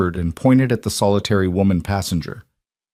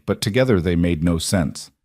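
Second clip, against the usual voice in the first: ordinary delivery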